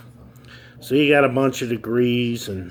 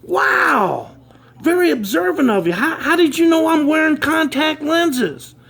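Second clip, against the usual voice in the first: much higher, louder, amazed delight; impressed surprise; 5 dB louder than usual. Acted. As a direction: gush at the kid's sharp eye. CEO impressed by kid.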